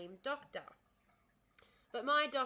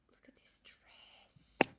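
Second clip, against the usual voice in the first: whisper; 2 semitones higher than usual. Real, not acted. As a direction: whisper your admiration. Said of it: "I was just whispering".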